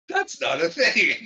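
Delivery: high-pitched protest through real laughter; helpless giggling disbelief; laughing. Real, not acted. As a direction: say it while cracking up. Cracking up at salt substitute.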